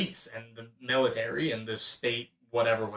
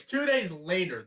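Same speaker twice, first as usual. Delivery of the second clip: usual speaking voice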